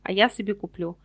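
ordinary delivery

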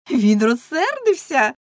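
loud, slow, teasing exclamation; mocking women. Women mocking angry man, loud teasing drawl. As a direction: tease him with drawn-out mock astonishment.

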